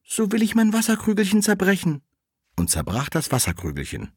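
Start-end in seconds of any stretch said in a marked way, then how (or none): 0.0-2.0 put-on the girl asks the shaking tree in a high childlike voice; little girl. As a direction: ask like a small child. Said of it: "Da sprach das Mädchen".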